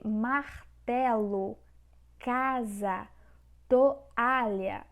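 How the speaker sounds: martelo, casa, toalha slowly divided into syllables. Shown as a demonstration. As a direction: pause inside martelo and toalha, each part distinct. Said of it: "estão separadas corretamente".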